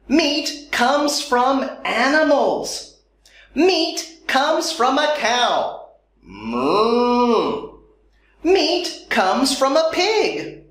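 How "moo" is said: playful mooing like a cow. Pretend. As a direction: moo like a cow for kids. Cow sound after naming cow.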